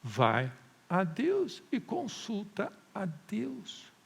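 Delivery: ordinary talk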